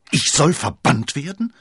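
laute, hohe Empörung; empört und ungläubig herausrufen; entrüstet. A narrator's reading. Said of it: "rief Milady entrüstet". (entrüsteter Ausruf)

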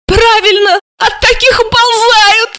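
very high, emphatic agreement; girl chiming in. A narrator's reading. Alisa backing him up from the stairs, emphatic. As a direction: chime in way up high, drawing out the end. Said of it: "Алиса его поддержала".

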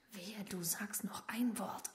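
low whispered warning; whispered threat. Acted. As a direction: hiss the threat quietly.

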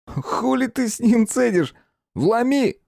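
very high, impatient urging; slow, high-pitched belligerent jeer; aggressive goading. A narrator's reading. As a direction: egg him on to hit, voice way up. Thug eggs mate to attack.

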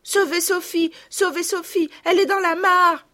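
put-on high, loud cries of a panicked little girl; high-pitched alarm, pushed loud; frantic cry. A narrator's reading. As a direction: cry out urgently for someone to save her. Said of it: "criait Marguerite".

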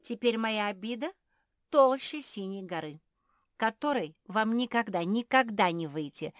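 loud, high, vengeful declaration; grand, wounded indignation; offended tirade. A narrator's reading. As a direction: pronounce a curse on them all. Offended frog curses the dogs.

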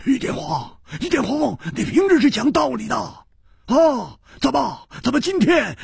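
high, urgent crowd-style yelling; loud shouting. A narrator's reading. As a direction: shout accusingly in rapid high bursts. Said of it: "大声地喝道". (纷纷大声喝问)

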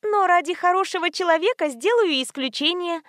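high, sweetly obliging voice; smiling. A narrator's reading. Nurse bribed into making exception, smiling. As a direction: give in with an audible smile. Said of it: "заулыбалась медсестра".

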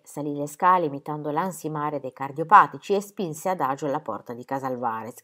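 usual speaking voice

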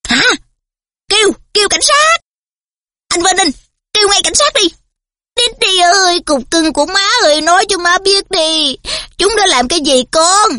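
high, frantic shriek; shrieking mother. A narrator's reading. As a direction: shriek in panic for the police. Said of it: "dì Petunia đã tru tréo lên".

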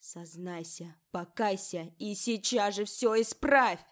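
building from plain to shrieking; breaking into a scream. A narrator's reading. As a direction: demand that bursts into a scream. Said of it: "сорвалась на крик, который перешёл в визг".

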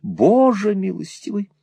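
high-pitched motherly exclamation; exclamation of alarm. A narrator's reading. Mother exclaims to her son in alarm, high exclamation. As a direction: cry out to heaven, voice leaping up. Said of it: "воскликнула миссис Никлеби".

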